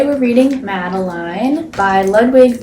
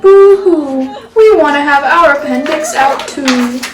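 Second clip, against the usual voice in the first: high, loud hammed-up wailing; mock crying; 5 semitones higher, 6 dB louder than usual. Girls begging Miss Clavell, mock tearful high wail. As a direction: whine it like jealous little girls. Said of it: "all the little girls cried".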